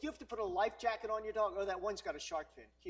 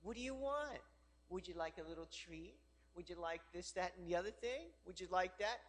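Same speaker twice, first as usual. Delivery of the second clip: soft, lightly coaxing pet-owner voice; mock indulgent owner, quieter; doting dog owner; 2 semitones lower, 7 dB quieter than usual. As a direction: gently fuss over a little dog. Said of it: "the owner goes".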